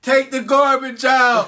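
slow, high, laughing imitation; laughing mock order. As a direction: drawl the chore order mockingly. Mocking demand, laughing.

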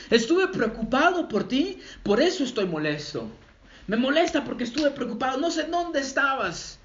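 a hint of a worried parent raising the voice, up high; mock angry parent. Padre preocupado regaña al hijo, medio gritando. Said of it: "Y empieza a gritar el padre de familia".

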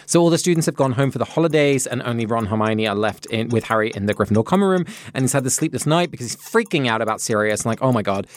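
racing through the story against the clock; rapid-fire recap. Real, not acted. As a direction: gabble the plot excitedly in a rush. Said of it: "capable of speaking that fast".

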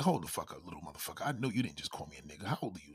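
low, soft menacing scold; quiet, threatening low rebuke; low stern warning. Retold or imitated. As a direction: warn the kid under your breath. Adult confronting the kid.

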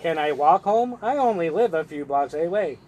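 usual speaking voice

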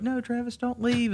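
high, pleading mock voice; pleading coworkers. Coworkers begging Travis not to quit, high pleading voice. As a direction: beg him to stay in a high whine. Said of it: "they were like".

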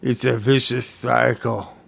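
slow, drawn-out movie-villain confession; Fat Bastard. Acted. Quoting a film character to a friend, slow character impression. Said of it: "to quote, uh, that great cinematic character, Fat Bastard".

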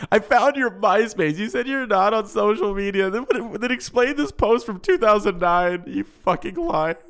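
mock angry outburst. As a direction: play an outraged accuser, voice pushed high. Acted confrontation over a hidden MySpace.

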